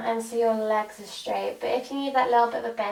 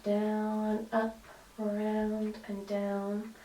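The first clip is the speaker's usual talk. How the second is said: ordinary talk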